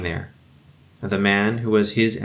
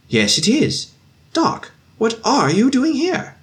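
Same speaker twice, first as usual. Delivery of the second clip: surprised; slower than usual. Acted. Officer recognizing the doctor.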